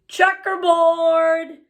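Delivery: a loud, high, drawn-out call; shouted. A narrator's reading. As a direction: bellow the word slowly across the room. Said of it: "Someone else shouted".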